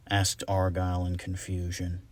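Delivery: ordinary delivery, nothing marked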